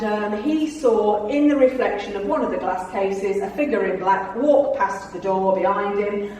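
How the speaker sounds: usual speaking voice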